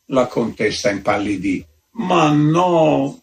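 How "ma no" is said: a light plaintive moan, higher. A narrator's reading. Pale countess protests to the visitor, with a mild moan. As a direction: whimper the denial, understated.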